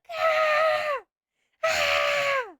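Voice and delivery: high, hammed-up scream of terror; demo scream. Shown as a demonstration. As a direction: scream in mock fear on cue. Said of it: "I'm screaming right now".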